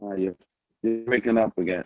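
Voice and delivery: ordinary talk, nothing marked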